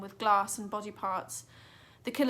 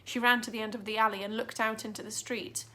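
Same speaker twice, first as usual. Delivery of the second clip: usual speaking voice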